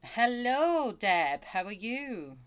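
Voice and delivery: loud, high, sing-out hello; cheery greeting. Real, not acted. Greeting Deb as she joins the stream, cheery call. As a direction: call it out high, then ease down. Calling greeting to arriving viewer.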